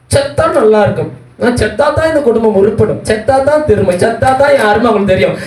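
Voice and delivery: imitated high-pitched domestic moaning; mock lament. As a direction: mimic an aggrieved relative wailing complaints. Mimics family member's wish to die. (உயர்ந்த குரலில் புலம்புவது போல நடிப்பு)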